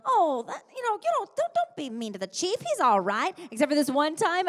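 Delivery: lightly sing-song defending the Chief; mock sweet scolding. Her character teasing the Lady of the Lake, high playful scold. Her recorded line in episode.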